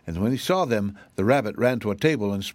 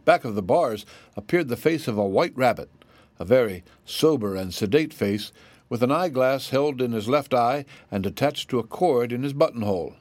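plain reading voice

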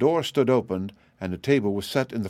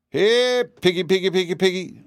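high, louder pig call on one held pitch; anxious calling; 9 semitones higher, 3 dB louder than usual. A narrator's reading. Master calling his vanished piglets, anxious call. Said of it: "called their master anxiously".

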